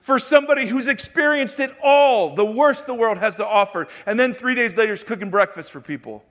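loud, high, soaring sermon voice; full-force preaching. Acted. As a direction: preach it out to the whole church.

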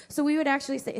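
ordinary talk

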